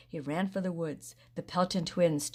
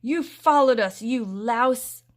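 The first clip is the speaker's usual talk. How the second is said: loud, higher angry yell; 7 semitones higher, 9 dB louder, slower than usual. A narrator's reading. Sam angrily yelling at Rusty. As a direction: scold him at full voice. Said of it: "yelled Sam".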